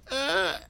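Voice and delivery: high, strangled "uh"; imitated panicked squawk. Interviewer imagining being told to sing on the spot, high helpless squawk. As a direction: let out a high, helpless noise. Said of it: "what would come out of my mouth".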